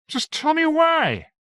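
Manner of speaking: pleading Eric. Acted. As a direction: drag it out, begging for an answer. Captain begs Valia for a reason.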